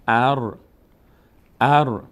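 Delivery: "ار" closed on a trilled R; vowelless ر. Shown as a demonstration. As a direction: join short a straight into R in "ار". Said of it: "را ساکنه باید بچسبه". («اَر» با رایِ ساکن)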